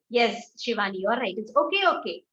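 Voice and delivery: normal talking voice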